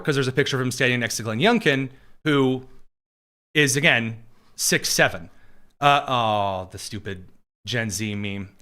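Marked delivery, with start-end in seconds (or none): none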